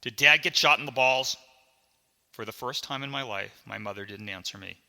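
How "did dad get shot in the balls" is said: loud, quick, urgent question; cutting in. Acted. Boy cuts mom off to ask about dad, loud and urgent. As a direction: blurt it over the other person. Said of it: "I cut her off".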